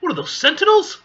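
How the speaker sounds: loud, high, incredulous question; shocked. Real, not acted. Reader reacting to page reveal, shocked. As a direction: blurt it out in disbelief.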